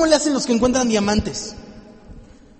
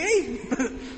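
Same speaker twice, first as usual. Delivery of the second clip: short laugh; 2 semitones higher, 7 dB quieter than usual. Real, not acted. Laughs at own joke.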